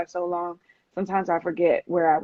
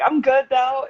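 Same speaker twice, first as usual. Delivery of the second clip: playing it cool; 6 semitones higher, 3 dB louder than usual. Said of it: "you're trying to play it cool".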